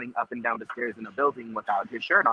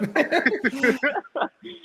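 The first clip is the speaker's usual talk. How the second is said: high-pitched burst of laughter; real high giggling laugh; 14 semitones higher than usual. Real, not acted. Laughing in the shared joke.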